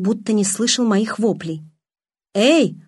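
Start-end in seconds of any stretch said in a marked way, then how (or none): 2.3-2.8 loud, higher hey after someone; calling out. A narrator's reading. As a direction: call after him across the distance. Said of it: "окликнул его Майк".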